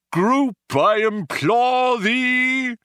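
very high, slow, impassioned alien declaiming; merciless Vogon. Acted. The Vogon declaims his poem at his prisoners, slow and soaring. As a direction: stretch every word in grand torment. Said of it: "continued the merciless Vogon".

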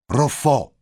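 loud, high bark of a commander; sharp shout, pitch falling at the end; barked call. A narrator's reading. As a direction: bark the cadet's name to startle him. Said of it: "blaffte eine Stimme hinter Brunetti".

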